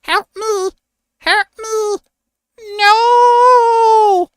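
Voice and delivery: high panicked screams; shrill cry for help. Acted. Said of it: "you start to hear the shrill cry of".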